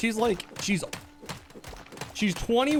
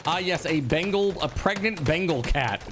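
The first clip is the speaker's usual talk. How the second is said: usual speaking voice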